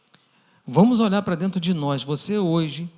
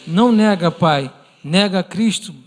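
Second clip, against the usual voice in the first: louder, desperate crying plea to father; crying child's plea; 2 semitones higher, 7 dB louder than usual. Retold or imitated. Daughter crying to her father to deny Christ, louder and desperate. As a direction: beg him loudly through tears, slowing at the end. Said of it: "a filha chorando".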